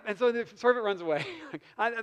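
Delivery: usual speaking voice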